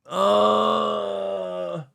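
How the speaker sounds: long, high moan; pained groan. Real, not acted. Streamer with sore chest, long groan. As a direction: let out a drawn-out achy groan.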